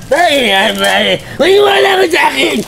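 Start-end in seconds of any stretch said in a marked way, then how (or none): 1.4-2.6 shout. Acted. Insisting loudly in argument.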